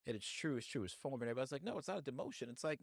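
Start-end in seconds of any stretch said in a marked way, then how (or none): 1.6-2.5 higher-pitched imitation of people protesting; mock denial. Everybody defending the job move, mock protest. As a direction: mimic a defensive objector, pitched up. Mimics people denying the demotion.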